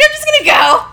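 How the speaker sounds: high giggly exit line; announcing departure. Retold or imitated. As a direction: sing it out to the room in embarrassment. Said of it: "I'm just like".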